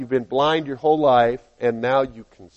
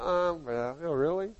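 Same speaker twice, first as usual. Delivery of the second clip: much softer, flat-toned oh really; unimpressed friend; 7 dB quieter than usual. Acted. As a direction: shrug it off coolly. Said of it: "And you go".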